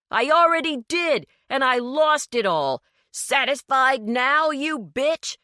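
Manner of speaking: high, loud bitter holler. A narrator's reading. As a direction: throw it at her in rage. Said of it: "Gary hollered in return".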